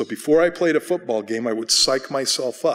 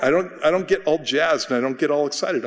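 ordinary talk

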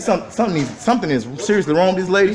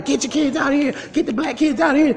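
imitated yelling; 8 semitones higher, 3 dB quieter than usual. Man reporting to an officer, high mock yell. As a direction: mimic her shrieked order in a lifted voice. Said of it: "yelling and screaming".

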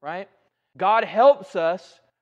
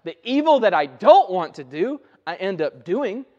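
forceful, raised voice quoting scripture; impassioned scripture quote; 2 semitones higher, 6 dB louder than usual. Retold or imitated. Preacher quoting Paul's struggle to the congregation, impassioned. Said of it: "Paul says it this way".

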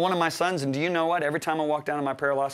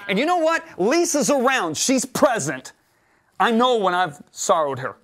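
preaching; 6 semitones higher, 5 dB louder than usual. Acted. Preaching builds.

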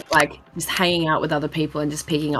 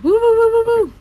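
high, loud excited woo; 7 dB louder than usual. Real, not acted. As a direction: cheer it out excitedly. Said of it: "That was me being really excited".

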